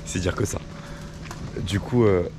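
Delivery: rough, worn-out hoarse voice. Real, not acted. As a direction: talk through a hoarse, cracked voice. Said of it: "j'ai la voix défoncée".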